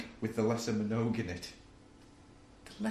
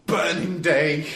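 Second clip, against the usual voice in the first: drawn-out, loud, higher announcement; mock exclamation; 4 semitones higher, 8 dB louder, slower than usual. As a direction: proclaim it slowly and loudly. Mock reply to what day is it.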